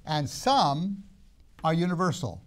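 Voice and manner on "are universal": normal talking voice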